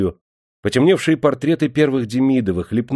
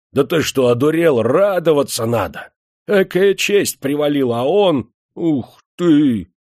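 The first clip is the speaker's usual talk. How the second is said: louder, higher scolding yell; old man shouting; 6 semitones higher, 4 dB louder than usual. A narrator's reading. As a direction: reproach him with a cry. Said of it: "старик закричал".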